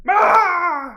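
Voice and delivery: high, loud creepy yell; sudden piercing shriek; Skinwalker scream. Retold or imitated. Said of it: "a guy out in a field just like".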